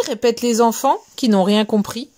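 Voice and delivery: ordinary delivery, nothing marked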